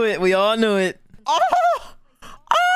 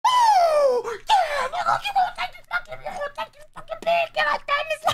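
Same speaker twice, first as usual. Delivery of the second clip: squealing excitement. Real, not acted. Excited high voice about pig.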